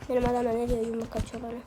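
normal talking voice

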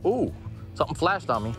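usual speaking voice

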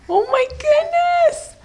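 high sing-song cheering. Real, not acted. Cheering baby up stairs.

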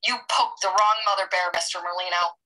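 ordinary delivery

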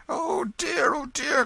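very high, loud fretful cry; distressed benevolent stranger. A narrator's reading. Stranger seeing Hugo writhe, crying out in pity. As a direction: exclaim in high-pitched alarm. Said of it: "cried the benevolent stranger".

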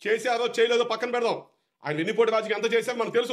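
ordinary delivery

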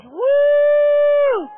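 long high, loud woo; ecstatic whoop. Real, not acted. Preacher overcome before her congregation, ecstatic whoop. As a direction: let out a soaring loud whoop.

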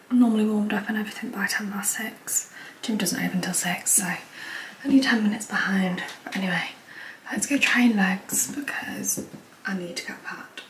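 soft whisper before the gym; early morning whisper. Real, not acted. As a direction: keep it hushed at dawn.

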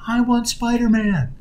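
put-on high, drawn-out toddler yell; imitated child's yell. Young son demanding his doll from his mom, mock yelling. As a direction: wail it slowly like a demanding small child. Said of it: "he's yelling at his mom".

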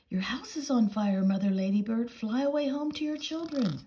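lilting nursery-rhyme call; singsong calling. Acted. Rhyme called to the ladybird.

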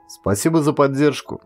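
ordinary delivery, nothing marked

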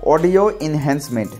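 ordinary delivery

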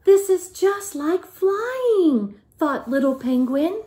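lively, sing-song picture-book reading; reading to children. A narrator's reading. Teacher reading a picture book aloud to the class, lively and sing-song. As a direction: tell it like a bedtime story to little ones.

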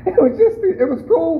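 breathy laugh into high words; cracking up remembering the brother. Real, not acted. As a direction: laugh your way into the line. Laughing at his story.

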